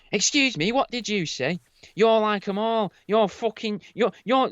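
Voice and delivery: put-on high, scolding old woman; imitating an angry old neighbour, high pitched; shrill old woman voice. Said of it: "she kept on".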